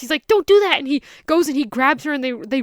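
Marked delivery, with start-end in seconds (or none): 0.3-0.8 panicked plea. Retold or imitated. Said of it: "And he, he panics".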